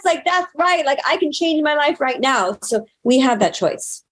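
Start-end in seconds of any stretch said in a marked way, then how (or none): 0.0-2.6 high, revved-up hype voice; pumped-up self-talk. Retold or imitated. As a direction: hype yourself up like a badass.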